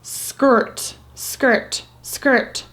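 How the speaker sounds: skirt whispered as SKERT with ER vowel; whispered skirt demo. Shown as a demonstration. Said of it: "it's sounding like an E R".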